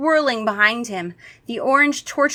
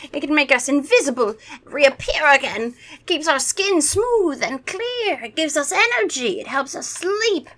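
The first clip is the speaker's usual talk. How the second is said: high, grandiose vampire sales pitch; hammed-up vampire listing; vampire impression; 7 semitones higher, 5 dB louder than usual. A narrator's reading. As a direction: proclaim each wonder as an old vampire. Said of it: "That's my vampire impression".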